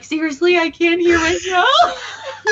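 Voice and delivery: mock exasperation. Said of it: "turn it up really loud 'cause I'm like".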